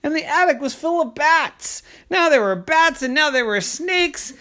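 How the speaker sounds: high, dramatic storyteller alarm; horrified narration. A narrator's reading. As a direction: tell the climax with mock horror. Narrator reveals bats in attic.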